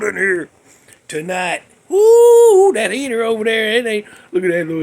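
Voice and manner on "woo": high, loud, sustained "woo"; loud whoop. Real, not acted. Host freezing in the room, high loud whoop. Reacting to cold and heater.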